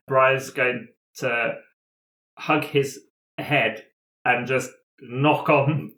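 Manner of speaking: normal talking voice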